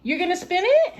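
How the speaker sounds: high, coaxing grandma voice to a little one; sing-song encouragement, pitched well up. Real, not acted. Grandma coaxing her granddaughter to spin, high baby talk. Answering toddler.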